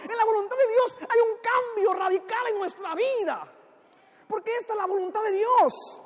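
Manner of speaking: high-pitched, impassioned preaching; fervent, declaiming sermon delivery. Real, not acted. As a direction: drive the moral home to the congregation. Preaches the moral after the joke.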